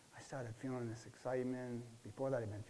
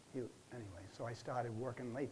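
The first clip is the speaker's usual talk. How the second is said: ordinary talk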